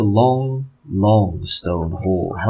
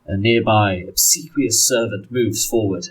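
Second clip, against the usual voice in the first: ordinary talk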